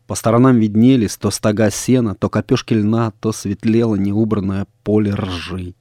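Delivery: ordinary delivery, nothing marked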